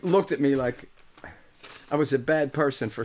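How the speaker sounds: usual speaking voice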